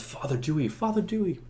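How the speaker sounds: soft murmur of an awed court; hushed crowd whisper. Acted. Court whispers as the holy man steps forward, hushed. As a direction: imitate whispering onlookers. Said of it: "they all whisper behind".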